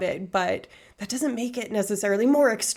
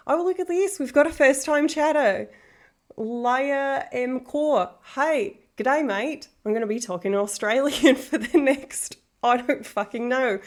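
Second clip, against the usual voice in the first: higher-pitched, put-on Australian; Australian accent; 4 semitones higher than usual. Streamer greeting a first-time chatter, Australian accent. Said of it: "y'all get an Australian [name]".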